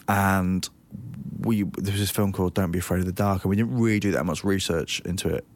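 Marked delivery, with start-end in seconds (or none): none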